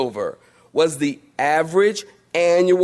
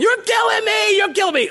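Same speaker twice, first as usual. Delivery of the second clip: much higher hammed-up child yell; mock child screaming; faster than usual. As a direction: wail like a kid getting a spanking. Child being spanked cries out.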